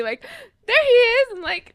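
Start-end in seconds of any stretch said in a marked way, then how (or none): 0.7-1.3 high, loud delighted call; joyful retold shout; so happy. Friend spotting the wrong man, so happy. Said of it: "like, so happy".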